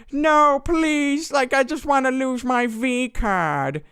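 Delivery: very high, whiny begging impression; mock pleading. Kid begs not to be blocked, mock desperate pleading. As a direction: wail it in a hammed-up desperate squeal.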